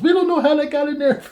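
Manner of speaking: high mock protest; nervous denial. Older brothers deny.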